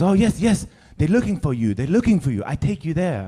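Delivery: put-on high, eager little Frenchman with French accent. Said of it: "little guy came out in his little French outfit".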